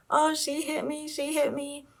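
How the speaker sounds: a heroine faking tears, lightly hammed-up; mock crying. Retelling a heroine faking an injury, mock crying. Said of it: "she's crying".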